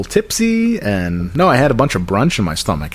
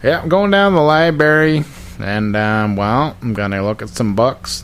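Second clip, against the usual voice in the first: upstate twang, pitched up at the start; a cheery Glens Falls local. Retold or imitated. Acting a library-going Glens Falls local in a Glens Falls voice. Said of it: "using that voice around the house".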